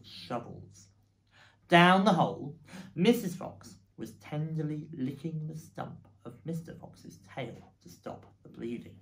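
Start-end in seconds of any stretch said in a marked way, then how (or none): none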